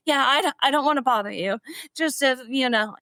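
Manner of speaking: high, hesitant starstruck fan; flustered fan. Retold or imitated. Retold flustered fan reply.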